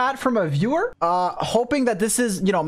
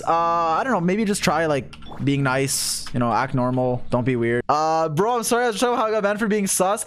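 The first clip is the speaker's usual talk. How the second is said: usual speaking voice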